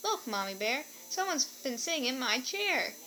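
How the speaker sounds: plain reading voice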